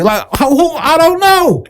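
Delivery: high-pitched baffled protest; mock bewilderment. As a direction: squeal it as if clueless. Mock reaction to a catchphrase.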